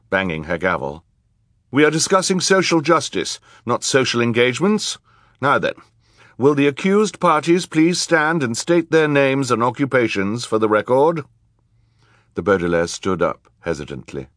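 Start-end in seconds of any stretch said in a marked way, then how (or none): 1.7-11.2 high, loud, authoritative court voice; rebuke, then command the room; judge, stern. A narrator's reading. Judge calling the accused to stand, stern.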